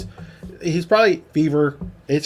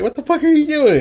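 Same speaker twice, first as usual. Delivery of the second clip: very high, louder disbelieving squawk; mock outrage; 13 semitones higher, 5 dB louder than usual. Co-host voicing Spaceman at gunpoint, mock outraged. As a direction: imitate a character's alarmed outburst.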